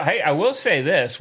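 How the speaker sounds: usual speaking voice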